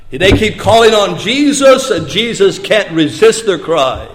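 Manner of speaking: ordinary talk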